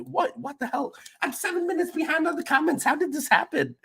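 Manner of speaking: very high-pitched squeaky astonishment; high squeaky voice of disbelief; startled disbelief. Real, not acted. Startled at being behind on comments.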